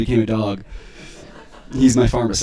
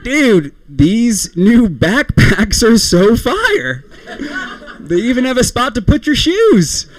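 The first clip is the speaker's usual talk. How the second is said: high-pitched gushing over gear; higher, mock-enthralled voice; excited friend; 7 semitones higher, slower than usual. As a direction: gush like a thrilled buddy. Friend gushing about new backpacks.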